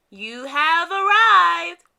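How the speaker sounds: slow, loud robotic announcement; flat machine voice reading directions; sat nav voice. Acted. The Santa Nav announces arrival, slow loud robotic voice. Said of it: "said the Santa Nav unhelpfully".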